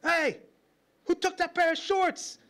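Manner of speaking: finding a drawer rifled by his sister; indignant. Retold or imitated. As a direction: squeal it in outrage at the open drawer. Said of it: "You'd open the drawer like".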